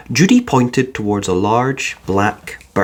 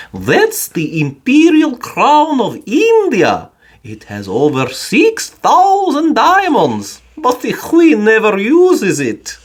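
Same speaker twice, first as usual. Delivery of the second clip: higher, slow guide explaining; kindly old guide; 11 semitones higher, 4 dB louder, slower than usual. A narrator's reading. Mister Gruber to Paddington, a slow guiding voice. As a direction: show off the crown gently like a tour guide.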